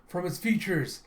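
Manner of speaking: acted alarmed squad member; urgent radio report.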